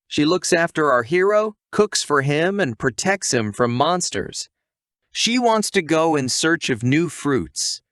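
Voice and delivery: ordinary delivery, nothing marked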